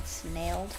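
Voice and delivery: Snailed said softly with a rising tune; savoring a word. Shown as a demonstration. Reader tasting the word Snailed, softly rising. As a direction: relish the word Snailed, lift it at the end. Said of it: "Ooh, that's a good word".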